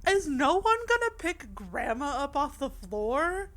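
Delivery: loud, slow disbelieving plea; is no one going to help her, loudly; exasperated outcry. Real, not acted. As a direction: drag it out in mock outrage. Exasperated plea about grandma.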